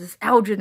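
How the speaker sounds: loud ELGIN with a j sound; soft-g ELGIN. Retold or imitated. People on her unit insisting, loud ELGIN with a j sound. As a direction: insist loudly, ELGIN with g as in gem. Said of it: "on my unit says".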